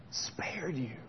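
slow unvoiced whisper. Real, not acted. Preacher telling congregation softly, slow whisper. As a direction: drop to a hush.

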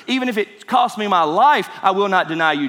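forceful, insistent promise; emphatic vow. Retold or imitated. Peter swearing he will not deny Jesus, emphatic. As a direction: pledge it with firm, punched stress. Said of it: "Even after that emphatic answer".

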